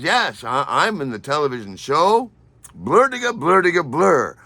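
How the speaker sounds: high, booming mock boast; imitating a loud braggart; loud, pompous. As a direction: trumpet the show title self-importantly. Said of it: "Why are you so loud?".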